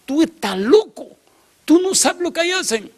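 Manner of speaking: very high-pitched mock scolding; alarmed mother. Mother learns son visited that house, shrieky alarmed scolding. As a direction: imitate a horrified mother, pitch way up.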